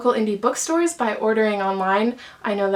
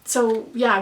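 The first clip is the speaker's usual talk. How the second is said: ordinary talk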